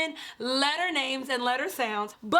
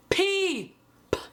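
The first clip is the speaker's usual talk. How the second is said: usual speaking voice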